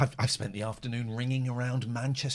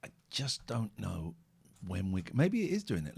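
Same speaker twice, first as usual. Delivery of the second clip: normal talking voice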